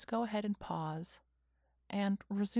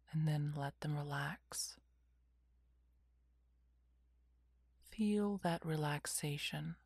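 whispered guided meditation; slow calming whisper, lots of pauses; soft spa whisper; 2 semitones lower, 4 dB quieter than usual. Acted.

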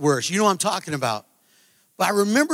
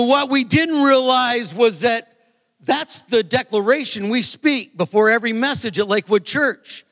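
normal talking voice

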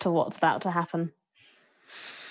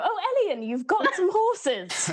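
pointedly loud; 11 semitones higher than usual. Acted. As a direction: say it loudly to change the subject. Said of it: "very loudly go".

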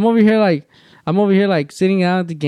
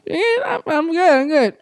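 a mock-casual no; 13 semitones higher, slower than usual. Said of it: "she's like".

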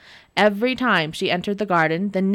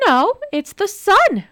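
very high, slow, teasing; grinning; 12 semitones higher, 6 dB louder, slower than usual. Acted. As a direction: tease with a grin. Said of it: "grinned Nora".

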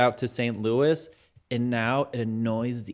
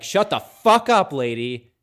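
annoyed outburst; 4 semitones higher, 8 dB louder than usual. Retold or imitated.

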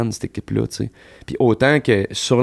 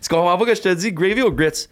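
mock impatient Southern waitress, higher; 8 semitones higher than usual. Impatient waitress pressing a confused customer for an answer. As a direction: fire the question at him like a rushed waitress. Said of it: "parce que t'es comme".

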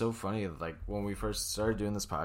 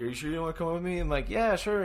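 high mock voice; 9 semitones higher, 6 dB louder, faster than usual. Retold friends' exchange.

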